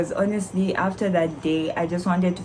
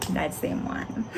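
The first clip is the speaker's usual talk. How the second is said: the same words whispered, much softer; sheepish whisper; 2 semitones lower, 7 dB quieter, slower than usual. Retold or imitated. Student to examiner, repeating her answer in a sheepish whisper. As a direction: shrink away as you repeat it.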